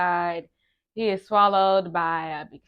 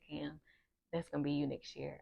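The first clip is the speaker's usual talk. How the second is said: leaning-in aside; 4 semitones lower, 13 dB quieter than usual. Retold or imitated. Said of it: "had leaned in and said".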